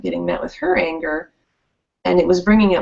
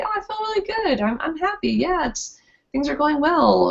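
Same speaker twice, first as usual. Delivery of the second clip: happy; 9 semitones higher, 3 dB quieter than usual. Retold or imitated. Quoting a truly happy person.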